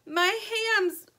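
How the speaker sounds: high broken sobbing words; crying too hard to finish. A narrator's reading. Anna bursts into tears over her hamster, sobbing.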